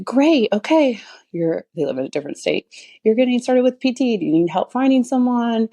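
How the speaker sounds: high, peppy, encouraging; lightly played overeager helper; chirpy upbeat self-quote. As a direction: bounce it up like a cheerful cheerleader. Upbeat nudge to family member.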